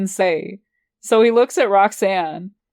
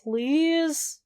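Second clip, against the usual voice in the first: pouting; 7 semitones higher, 5 dB quieter than usual. A narrator's reading. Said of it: "I pout".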